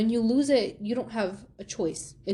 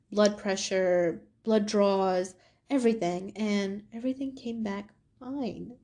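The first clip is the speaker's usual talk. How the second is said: ordinary delivery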